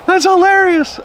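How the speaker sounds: high, laughing delivery. Real, not acted. Amused by own story of the stranger, really laughing.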